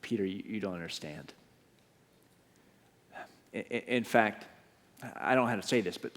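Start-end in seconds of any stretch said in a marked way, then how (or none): 0.0-1.3 gentle Jesus. Acted. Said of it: "And then Jesus says to Peter".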